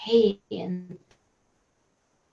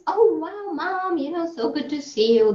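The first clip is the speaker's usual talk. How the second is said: high, bright son greeting his mum; light up at seeing Mom; happy greeting; 8 semitones higher than usual. Acted. Farmer greets his visiting mother, high and delighted.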